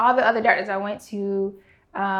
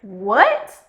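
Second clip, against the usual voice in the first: louder stunned question; shocked; 8 dB louder than usual. Retold or imitated. Patient hearing it looks like lupus, stunned. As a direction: blurt it out in shock. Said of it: "And I'm like".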